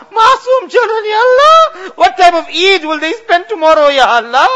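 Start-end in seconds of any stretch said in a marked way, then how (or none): 0.1-1.9 crying out. Real, not acted. Imam crying out to Allah for orphaned children.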